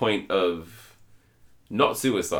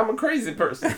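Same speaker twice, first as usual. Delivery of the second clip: very high, pixie-like hillbilly; high-pitched comic hillbilly impression; funny hillbilly voice; 13 semitones higher than usual. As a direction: imitate an odd hillbilly in a tiny high voice. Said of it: "He spoke with this funny hillbilly voice".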